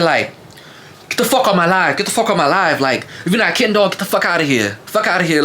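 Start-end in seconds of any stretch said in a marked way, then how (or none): 1.1-5.4 imitating her high Baltimore accent, crashing out; mock her Baltimore accent, pitched up; high-pitched mimicry of a Baltimore accent. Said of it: "her little Baltimore accent".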